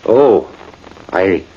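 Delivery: ordinary talk, nothing marked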